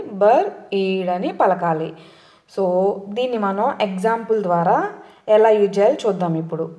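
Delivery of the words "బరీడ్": బర్ఈడ్ slowly as బర్, then ఈడ్; drawn-out split of బర్ఈడ్; chunked repeat-after-me. Shown as a demonstration. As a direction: separate the two halves of బర్ఈడ్ for the class. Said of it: "నాతో పాటు పలకండి".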